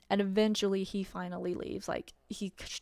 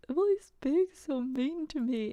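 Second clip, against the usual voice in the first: higher, whiny crybaby imitation of the ex; mock whiny crying ex; 5 semitones higher, 3 dB louder than usual. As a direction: whine it like a sulking child, half-hearted sobbing.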